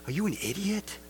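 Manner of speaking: sweetly soft, sarcastic question; mock soft. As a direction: ask it gently, as if being kind. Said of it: "I'll say something so soft like".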